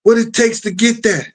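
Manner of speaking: lightly voiced Sean hitting on a girl; imitating Sean. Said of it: "Sean was like".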